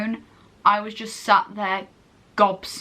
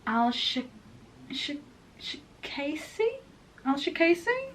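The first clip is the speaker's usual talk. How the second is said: soft, high, questioning; unsure pronunciation; 5 semitones higher, 8 dB quieter than usual. Real, not acted. Reviewer attempting an actress's last name, unsure. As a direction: try the name out tentatively, rising at the end. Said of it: "I'm gonna pronounce her last name wrong".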